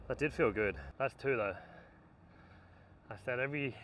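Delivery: ordinary talk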